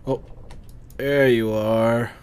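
ordinary talk

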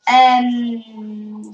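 loud strained groan; frustrated groan. Real, not acted. Gamer groaning at the game, loud strained groan.

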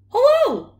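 high, loud dog yelp; very high, loud bark of a hello; barked greeting. A narrator's reading. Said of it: "she barked".

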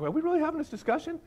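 high, quick, squeaky-pitched protest; hurried mock-incredulous quote; mock exasperation. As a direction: pitch it way up in disbelief. Voicing an exasperated spouse in the car.

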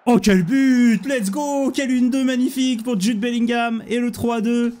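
high, loud goal celebration; excited sports commentary. Real, not acted.

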